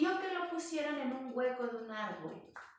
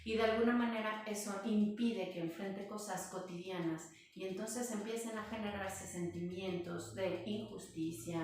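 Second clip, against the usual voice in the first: ordinary talk, nothing marked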